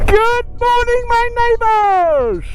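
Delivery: high, slow, drawn-out call to nobody; shouted greeting. Real, not acted. Vlogger greeting the empty hills, high slow hollering. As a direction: sing it out across the valley like a greeting. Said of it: "just feel like shouting here".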